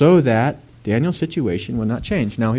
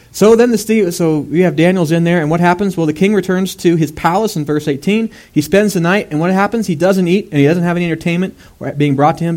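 loud, higher, driving sermon voice; full-force preaching; 7 semitones higher, 5 dB louder than usual. Acted. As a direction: preach it out to the congregation.

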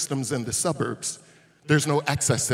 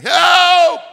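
high, loud shout of Help!; cry for help; 15 dB louder than usual. Retold or imitated. Beaten man on the road calling for help, shouting. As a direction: yell Help! like a man left for dead.

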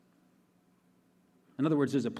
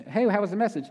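higher, louder, chirpy friend's question; mock friend asking; 8 semitones higher, 5 dB louder, faster than usual. Friend asks about the sermon, playful higher voice. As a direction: play a curious friend after church. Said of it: "you can say to your friends".

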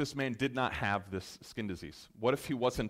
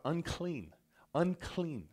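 a hint of a calling-out cry, raised; mock leper's call; 7 semitones higher, slower than usual. Preacher voicing the leper warning people away, mild mock cry. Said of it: "you had to yell out".

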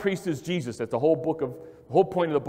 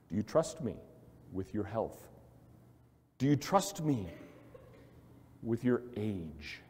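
soft voice of God; 5 semitones lower, 7 dB quieter than usual. Acted. God asks the listener gently.

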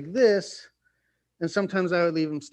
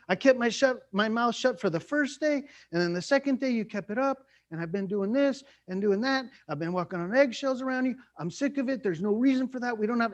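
higher-pitched griping about walking on eggshells; mock fed-up complaint; 6 semitones higher than usual. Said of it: "You're like".

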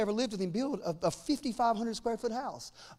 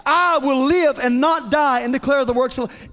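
loud congregational declaration; 4 semitones higher, 11 dB louder than usual. Shown as a demonstration. Preacher leading the church in a loud, bold unison declaration. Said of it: "Say it with me".

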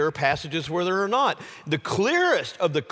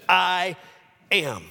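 "I" held long and raised, pause, then "am" falling; emphatic I AM; 3 semitones higher than usual. Shown as a demonstration. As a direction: weigh "I" and "am" as two separate words. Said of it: "an emphatic statement that says".

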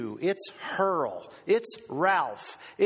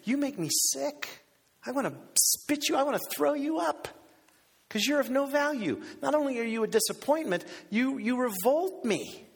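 high, frank rebuke; acted disgust, said straight; honest and frank; 7 semitones higher than usual. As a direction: tell them bluntly, voice raised in pitch. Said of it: "He's being as honest and frank".

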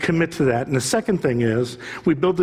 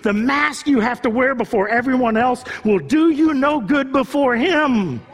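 preaching at full force; 7 semitones higher than usual. Acted. Pastor urging church to embrace authenticity, preaching at full force. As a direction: pour it out like a revival preacher.

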